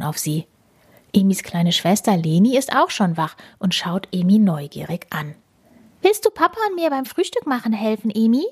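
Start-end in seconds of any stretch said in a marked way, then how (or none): 6.0-8.5 high, fast little sister; higher, fast child voice. Acted. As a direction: chirp it quickly like a small girl. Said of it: "fragt Leni".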